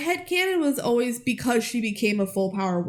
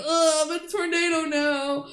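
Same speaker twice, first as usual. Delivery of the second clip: mock bored brother; 5 semitones higher than usual.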